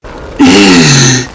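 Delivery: sharp startled gasp; shocked gasp. Acted. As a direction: gasp in disbelief. Villain startled Bruce awoke.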